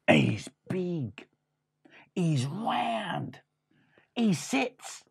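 hushed, scary build-up; ominous whisper. Acted. Mouse brother warning Freddy about the cat, ominous whisper. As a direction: whisper it like a dreadful secret.

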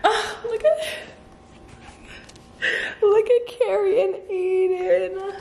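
high, slow, teary squeak; tearful gushing. Real, not acted. Fan looking at Carrie and Aiden, tearful gushing. Crying over the gifted book.

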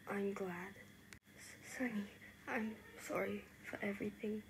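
very soft, hushed apology and reassurance; quiet and sincere; gentle reconciliation. Acted. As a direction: speak tenderly, almost whispering. Two friends apologise and reconcile.

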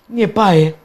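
ordinary talk, nothing marked

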